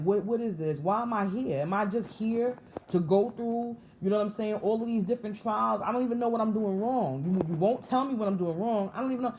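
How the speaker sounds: going off, frustrated. Retold or imitated. Speaker retells questioning God about endless trials, exasperated and sincere. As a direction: pile up exasperated questions in earnest. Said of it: "And I was going off".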